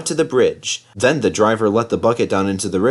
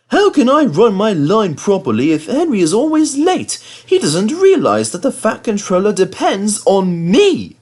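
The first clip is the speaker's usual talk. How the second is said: higher, louder cross engine voice; cross little engine; 10 semitones higher, 4 dB louder than usual. Acted. Thomas complains about late Henry, higher and cross. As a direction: grumble crossly like a fussy engine. Thomas grumbles while waiting.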